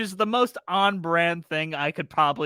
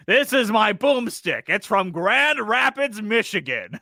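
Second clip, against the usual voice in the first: slow, raised Ash-style hero voice; Bruce Campbell impression; 5 semitones higher, 5 dB louder, slower than usual. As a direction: deliver it like a cocky action hero. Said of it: "he's like".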